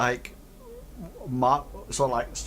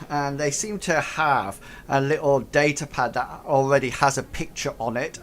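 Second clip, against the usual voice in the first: ordinary talk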